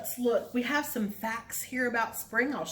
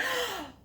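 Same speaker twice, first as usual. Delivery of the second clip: excited gasp; 13 semitones higher than usual. Shown as a demonstration. Flower bursting open game.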